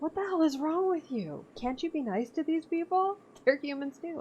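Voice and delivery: high, indignant scolding; outraged do-gooder. Retold or imitated. Other players scolding the colonizer, high indignant mock voice. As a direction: scold them like a horrified hippie. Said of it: "the other people are gonna be like".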